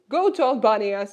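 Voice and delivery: "go to Albania" called out loud and eager; rally them all to visit Albania; loud urging. Real, not acted. Streamer calling viewers to visit Albania, loud eager call.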